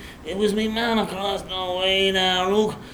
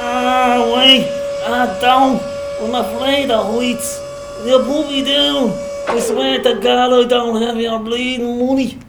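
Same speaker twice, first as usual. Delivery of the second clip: loud, raised, frightened protest; panicked pleading; 3 semitones higher, 3 dB louder than usual. Acted. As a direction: beg in panic to be let down. Hoisted up, afraid of heights, pleading.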